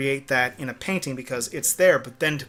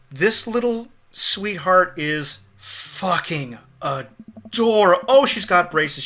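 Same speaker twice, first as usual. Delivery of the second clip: slow, stretched-out adoration, building; real gushing; 4 semitones higher, 4 dB louder, much slower than usual. Real, not acted. Gushing over the child character.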